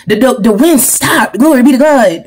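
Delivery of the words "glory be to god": ordinary talk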